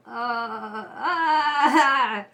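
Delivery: loud laughter breaking into a cry-like wail; can't hold back the laugh; loud burst of laughter. Real, not acted. Reader laughs loudly at the heartbreak in her own reading.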